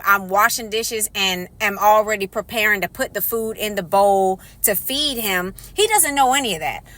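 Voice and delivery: ordinary talk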